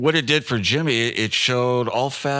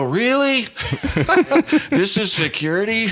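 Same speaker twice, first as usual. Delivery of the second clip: very high, loud squeak of disbelief; hammed-up astonishment breaking into laughter; incredulous; 12 semitones higher, 5 dB louder than usual. Storyteller can't believe how lax security is, incredulous. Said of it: "And I go".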